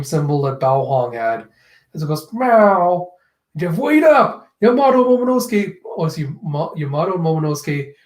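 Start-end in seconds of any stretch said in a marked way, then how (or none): 2.3-3.1 loud, pitched-up meow; imitated cat meow. 3.8-5.8 high, loud acted shout; mock urgent yell; calling out. Calling after fleeing characters.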